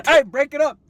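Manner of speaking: loud, high yell to split up the fight; shouted command. Pretend. Breaking up the sibling fight, a loud pretend shout. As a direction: bark it at the brawlers.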